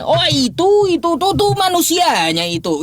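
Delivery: sneering imitation of a debater's excuse; mocking the opponent's excuse. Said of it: "jangan kau beralasan".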